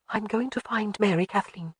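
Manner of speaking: soft, hushed voice; whispered. Acted. Jamie quietly telling Alec she will find her daughter, hushed. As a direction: say it under her breath. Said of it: "she whispered".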